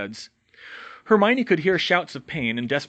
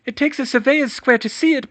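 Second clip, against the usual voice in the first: higher, louder strained plea; pained plea; 13 semitones higher, 7 dB louder than usual. Acted. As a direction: plead through the pain. Hermione pleading, crushed.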